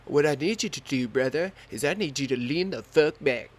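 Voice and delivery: loud, high commanding dude; imitating the instructor. Instructor giving jump orders over the wind, loud. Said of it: "he was like".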